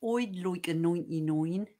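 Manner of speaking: put-on broad rural Essex accent, slow, oi for I. Co-host orders ice cream in rural Essex accent. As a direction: Suffolk vowels, oi in like and nine. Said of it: "can I just say my best accent".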